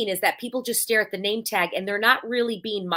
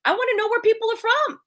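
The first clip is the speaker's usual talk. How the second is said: much higher, eager and curious; eager question; 14 semitones higher, 3 dB louder than usual. Shown as a demonstration. Asking people at an Arizona event, eagerly curious. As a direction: sound truly keen to know. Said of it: "that's gonna be a big question".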